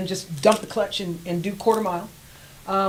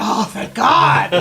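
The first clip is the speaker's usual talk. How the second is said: retold relief; 4 semitones higher, 6 dB louder, much slower than usual. Relief on seeing her hand.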